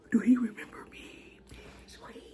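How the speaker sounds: hushed panicked thought; inner whisper. Retold or imitated. In her head wondering if he remembers her, panicked whisper. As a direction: whisper it to yourself, frantic. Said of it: "in my head".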